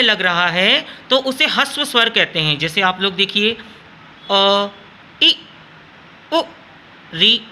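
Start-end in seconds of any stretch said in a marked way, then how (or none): 4.3-7.4 "अ, इ, उ, री" clipped short, no effort; quick light vowel sounds, each cut off fast; short vowels said quickly. Shown as a demonstration. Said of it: "इनमें हमें ज़्यादा जोर लगाने की ज़रूरत नहीं पड़ी". (ह्रस्व स्वर: "अ, इ, उ, री" जल्दी और बिना ज़ोर के)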